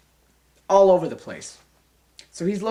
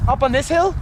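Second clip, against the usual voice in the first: usual speaking voice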